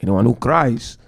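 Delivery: usual speaking voice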